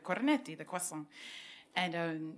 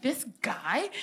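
high, rising disbelieving question on "This guy"; retold incredulity, "guy" lifting upward; incredulous echo; 5 semitones higher than usual. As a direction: question "guy" as if you can't believe it. Incredulous reaction to being called male.

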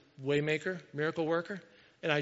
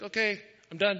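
mock-announce it, pitched up; exaggerated imitation of a worship leader wrapping up; exaggerated abrupt sign-off; 5 semitones higher, 4 dB louder than usual. Worship leader ending the set early, exaggerated abrupt sign-off. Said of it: "he didn't say it like that".

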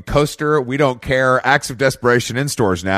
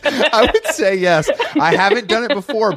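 laughing; 9 semitones higher than usual. Pretend. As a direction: laugh through the words. Repeated slower, laughing.

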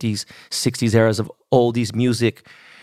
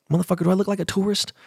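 higher and fast, mock indignant; indignant retort; 8 semitones higher, faster than usual. Retelling snapping at the photo seller, higher, fast indignation. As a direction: fire it off like a sarcastic insult.

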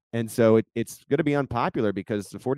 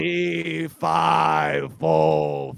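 booming fairytale giant shout; 5 semitones higher, 4 dB louder, much slower than usual. Acted. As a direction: bellow fee fi fo fum like a giant. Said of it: "that fantastic giant voice".

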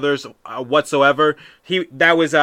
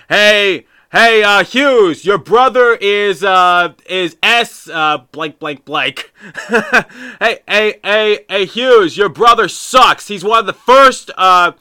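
loud, high heckling of the player; trash-talk yelling; 5 semitones higher, 5 dB louder, slower than usual. Retold or imitated. Fan heckling Luke Hughes through the glass, loud and high. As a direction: taunt him across the ice, at full voice. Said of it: "I'm just yelling from the top of my lungs".

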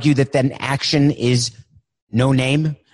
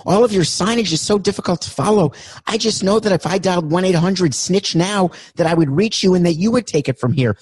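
high, anxious caller voice; high-pitched caller; 3 semitones higher than usual. Acted. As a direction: raise the voice, hurry to hang up.

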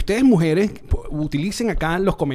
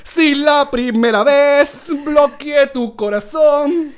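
slow, much higher and louder, hammed-up; mock melodrama; 9 semitones higher, 5 dB louder, much slower than usual. Teasing his friend with a mock dramatic line, slow and high. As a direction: ham it up like a telenovela.

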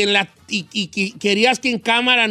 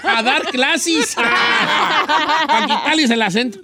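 words broken by laughter; laughing; 4 semitones higher than usual. Real, not acted. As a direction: laugh while you say it. Laughing through the idea.

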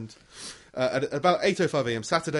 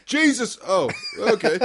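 high, loud, laughing surprise; startled waking; 7 semitones higher, 4 dB louder than usual. Retold or imitated. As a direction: jolt awake, then laugh. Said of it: "woken up to".

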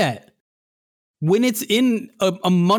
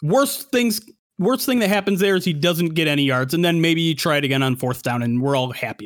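ordinary talk, nothing marked

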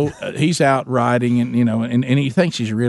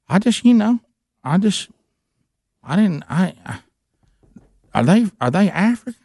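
imitating Gary, higher and halting; Gary's voice; 6 semitones higher than usual. Gary unsure whether the visitors are African, higher imitated voice. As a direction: stammer it out in a higher put-on voice. Gary's hesitant question retold.

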